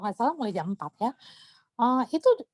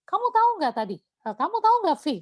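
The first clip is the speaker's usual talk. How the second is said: higher, mock-scolding questions; retold scolding; 7 semitones higher, faster than usual. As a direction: nag the friend who failed you. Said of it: "Tapi saya tetap dimarahin". (omelan tiruan, nada tinggi)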